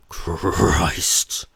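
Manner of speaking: strained grunt with a rolled R. A narrator's reading. Husband heard through the walls, grunting. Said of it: "his grunts carry through the walls".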